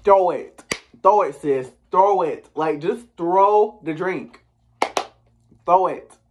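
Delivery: loud, high urging; emphatic, impatient goading; egging her on. Retold or imitated. Urging cast member to throw drink.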